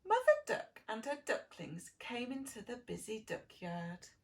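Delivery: usual speaking voice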